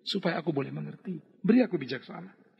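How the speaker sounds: quiet, humble asking for wisdom; soft humble prayer. Retold or imitated. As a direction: pray it softly and humbly. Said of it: "Engkau boleh berdoa dan berkata". (doa lirih dan rendah hati)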